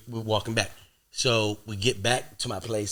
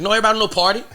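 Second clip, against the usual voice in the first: mocking laugh; 11 semitones higher, 10 dB louder, much faster than usual. Mocking the woman's words.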